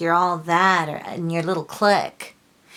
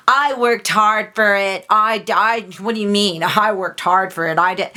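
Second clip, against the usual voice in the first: loud, higher-pitched mock defensiveness; indignant protest; 3 semitones higher, 8 dB louder than usual. Reader mimics people who won't be humble, indignant. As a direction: protest loudly as if your credit is being denied.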